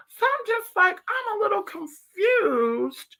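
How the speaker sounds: high and slow, feigned bafflement; mock confusion. Real, not acted. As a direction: play dumb up high, drawn out.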